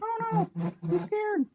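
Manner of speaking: very high, loud squeaky fright; scared toy car. Acted. Voicing the frightened race car, mock-scared squeal. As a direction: squeal it as a tiny panicking toy. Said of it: "But this one's scared now".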